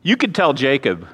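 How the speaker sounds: ordinary talk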